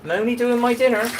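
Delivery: exasperated. Real, not acted. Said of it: "Why are we having these conversations".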